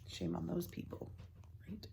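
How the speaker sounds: soft whispered aside. Real, not acted. Reader to children, a soft whispered aside. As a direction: lean in and whisper it. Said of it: "aside to listeners, whispered".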